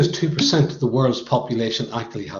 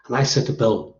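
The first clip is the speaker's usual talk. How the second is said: normal talking voice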